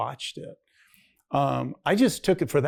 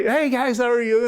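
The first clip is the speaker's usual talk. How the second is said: higher, louder hail to the guys; re-enacted friendly hello, pitched up; upbeat greeting; 9 semitones higher, 5 dB louder than usual. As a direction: greet a group brightly across a bar. Greeting men at the bar.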